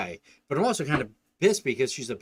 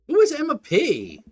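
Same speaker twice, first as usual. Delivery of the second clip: exasperated outburst; 12 semitones higher, 8 dB louder, slower than usual. Acted. Frantic dad worrying about daughter.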